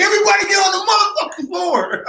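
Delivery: high, fast stick-up shout; mock robber yell. Friend jokes about robbing Trader Joe's, hammed-up stick-up shout.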